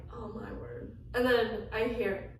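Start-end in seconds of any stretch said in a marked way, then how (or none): 0.1-1.0 breathless whisper. Retold or imitated. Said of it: "And I'm just like".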